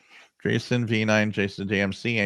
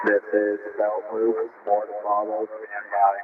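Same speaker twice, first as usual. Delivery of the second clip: radio broadcast. Real, not acted. Radio station voice on receiver.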